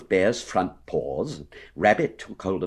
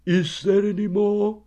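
high, anxious bear voice; 13 semitones higher than usual. A narrator's reading. Pooh anxiously asking for more food, anxious. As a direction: ask it high-pitched and worried. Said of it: "asked Winnie the Pooh anxiously".

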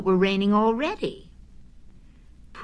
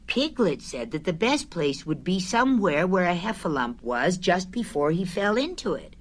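ordinary delivery, nothing marked

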